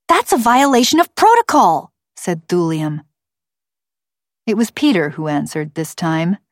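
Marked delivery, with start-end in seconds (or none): 0.1-1.9 put-on high, loud child's objection; high-pitched righteous complaint; indignant girl. A narrator's reading. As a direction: protest as an outraged girl. Girl objects to rule breaking.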